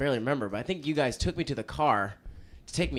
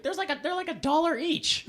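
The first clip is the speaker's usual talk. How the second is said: high quoted voice. Retold or imitated. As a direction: pitch it up like a clerk.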